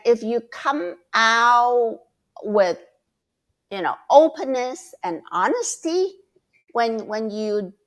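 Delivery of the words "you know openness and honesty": ordinary delivery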